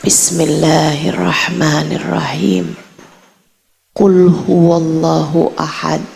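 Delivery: slow, low, measured Arabic recitation; solemn, unhurried scripture recital; Quran recitation. Retold or imitated. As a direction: recite the sura slowly, pitch dropped, pausing after each verse. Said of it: "Bacaannya begini".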